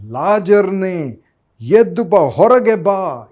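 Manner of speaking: slow, loud, high call into the tomb. Retold or imitated. ಯೇಸು ಲಾಜರನನ್ನು ಸಮಾಧಿಯಿಂದ ಕರೆಯುತ್ತಾ, ನಿಧಾನ ಮಹಾ ಕೂಗು. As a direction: summon Lazarus out with a great voice. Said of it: "ಮಹಾಶಬ್ದದಿಂದ ಆತನು ಕೂಗಿದ".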